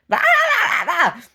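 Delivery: high shrieking bahs with a laugh; mock screaming. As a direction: shriek it like the crazy woman. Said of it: "she's, like, screaming".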